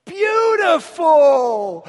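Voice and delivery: slow, very loud, high shout; loud child call. Retold or imitated. Little boy complimenting a stranger, loud shout. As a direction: belt the compliment out. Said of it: "And he said".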